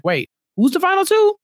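high, baffled "wait" question; mock confusion. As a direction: play the clueless latecomer catching up. Mocking a confused returning player.

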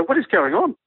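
high, incredulous question; retold bewilderment. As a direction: re-enact total bafflement, pitch lifted. Bewildered reaction to lion pen.

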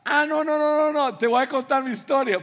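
high, loud, insistent cutting in; acted pushy conversation hog; self-centred interrupting. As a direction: butt in, eager to take over. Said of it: "Y tú no vas a".

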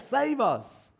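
high-pitched plea to be rescued; acted cry of pleading; cry for help. Shown as a demonstration. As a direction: call out for help on a high note. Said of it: "a kind of cry for help".

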